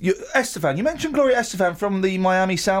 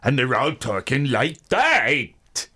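slow, fake American take on a Sheffield accent; mock the actors' botched Sheffield accent, slowly; film actors failing at a Sheffield accent; bad American Sheffield accent; 3 semitones lower, much slower than usual. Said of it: "none of them can do an English accent".

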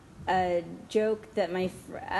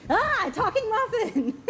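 much higher, louder, laughing squeal; shrieking punchline; 14 semitones higher, 4 dB louder than usual. Acted. The startled muffin, screamed through laughter. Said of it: "the other muffin goes".

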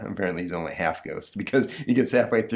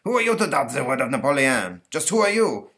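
put-on indignant French accent; 6 semitones higher, 4 dB louder than usual. Napoleon is offended.

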